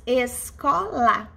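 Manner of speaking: "escola" slowly split into separate syllables. Shown as a demonstration. Teacher showing class a three-syllable word, syllable by syllable. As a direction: sound out "escola" one syllable at a time.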